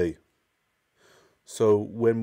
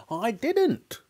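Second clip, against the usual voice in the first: high, cackling crone's voice; cackling old woman; 13 semitones higher than usual. Acted. Grandma teasing George's mother, high and cackling. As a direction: cackle it like a gleeful old witch. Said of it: "cackled the old woman".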